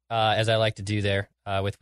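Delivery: ordinary talk, nothing marked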